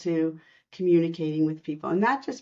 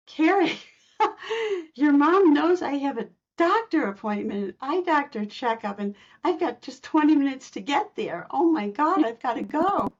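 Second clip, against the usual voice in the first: flustered excitement; 8 semitones higher than usual. Retold or imitated. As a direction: caught off guard, blurting it out with a laugh. Flustered about doctor appointment.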